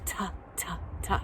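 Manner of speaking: Ta, ta, ta breathed out, voiceless and soft; whispered ta. Shown as a demonstration. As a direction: whisper the t sound. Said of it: "T says ta".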